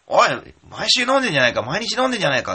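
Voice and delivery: high, loud mock rebuke; loud teasing protest from a pretend listener; imagined listener scolding. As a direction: call him out like a fed-up listener. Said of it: "って思うかもしんないですけれども".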